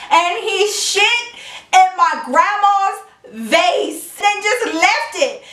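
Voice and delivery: stretched punchline of disbelief; slow disbelief. Acted. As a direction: drag it out in mock outrage. Stressing the outrageous punchline.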